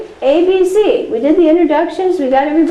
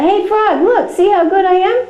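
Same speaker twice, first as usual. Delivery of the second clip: higher, boastful show-off calling for attention; bragging Toad; 6 semitones higher than usual. Pretend. Toad shows off to Frog, higher boastful brag. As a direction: look at me, I'm the best, much higher.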